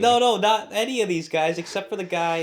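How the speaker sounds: high, loud, exasperated insisting; flustered protest. Real, not acted. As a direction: keep protesting loudly over the teasing. Still loudly protesting.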